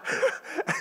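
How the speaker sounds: a high, breathy giggle that slips out; high squeaky laugh. Real, not acted. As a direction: let a quick high laugh escape. Laughing at bad lunch.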